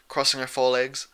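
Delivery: ordinary delivery, nothing marked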